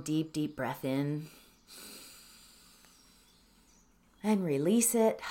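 slow, hushed meditation voice; soft words with long, restful pauses; guided meditation. Acted. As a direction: lead them gently into calm, trailing to near-whisper. Leads a breathing meditation.

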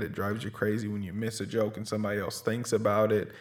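usual speaking voice

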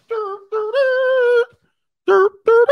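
high, loud, sung nonsense "dur dur"; mock dumb humming. Gen Z reacting to Pearl Harbor, mocking high sung hum. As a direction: sing "dur, dur" like a clueless kid. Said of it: "They'd be like".

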